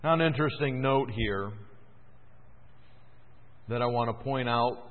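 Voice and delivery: usual speaking voice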